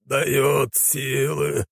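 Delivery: very slow, high-pitched mantra; old holy warrior chanting in a trance; dazed zealot. A narrator's reading. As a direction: drone it out high and drawn out. Old light warrior drones his mantra.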